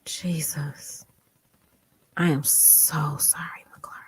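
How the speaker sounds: slow, whispered sympathy; heartfelt sorrow. Real, not acted. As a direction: let it trail away in a hushed breath. Overcome again, consoling the survivor.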